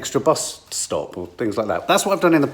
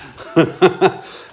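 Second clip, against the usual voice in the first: laugh; 6 semitones higher, 3 dB louder than usual. Real, not acted. Laughs at own remark.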